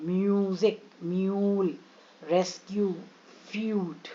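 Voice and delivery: म्यूजिक, म्यूल, रेस्क्यू with a stretched "yoo"; long U sounds. Shown as a demonstration. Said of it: "यू sound".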